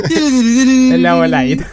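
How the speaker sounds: slow, high, laughing through the words. Real, not acted. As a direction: say it while cracking up. Laughing repeat of a joke.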